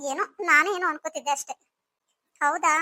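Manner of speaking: ordinary delivery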